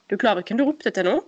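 normal talking voice

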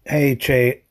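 usual speaking voice

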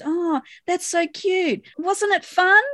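high, sugary mock praise; talking down to children. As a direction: coo at them like little kids. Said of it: "he talks to them like they're children".

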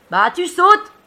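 high, loud shouted answer; yelled back. Acted. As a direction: yell the obvious answer down. Friend yelling back on tipping boat.

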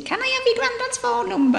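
high mock voice of elderly Betty; imitating Betty. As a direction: pitch it way up as cheeky old Betty asking. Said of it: "she always used to say to me".

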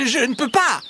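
high, strained fox voice; squeaky, effortful refusal; puffing fox. A narrator's reading. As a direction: refuse helplessly, pitched high. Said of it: "souffle le renard".

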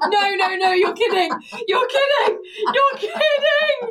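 high, loud, wailing disbelief; real scandalised squeal; shrieking disbelief. Real, not acted. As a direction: shriek it, helpless with shock. Hears an outrageous embarrassing story.

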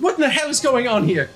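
high, loud outraged question; indignant demand. Acted. Bridget looking down at chaos, indignant high demand. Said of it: "she's like".

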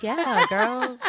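mock quote broken by a laugh; laughing Villanelle. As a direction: laugh through the words as Villanelle. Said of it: "And she's like".

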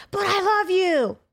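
high, loud, drawn-out wail; mock lover's yell.

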